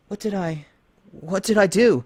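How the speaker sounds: high, halting horror; shaken and horrified. Acted. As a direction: stammer in shock. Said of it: "severely shaken".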